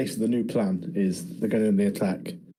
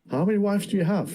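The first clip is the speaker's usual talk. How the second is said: higher, mock-incredulous question; mock surprise; 9 semitones higher than usual. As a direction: blurt it out, playing the shocked guest. Mocking Tyler's blunt reply.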